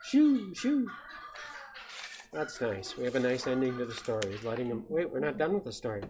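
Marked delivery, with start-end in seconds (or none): 0.0-0.9 high-pitched hooting shoo; shooing away. Acted. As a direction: shoo it off in a high owl hoot. Owl chases the mouse away.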